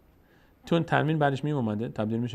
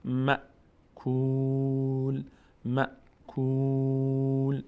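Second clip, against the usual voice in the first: slow drawn-out tajweed chant; Quran recitation; 3 semitones higher, much slower than usual. Shown as a demonstration. Teacher reciting the verse for the class. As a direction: recite الم یجعل slowly and evenly.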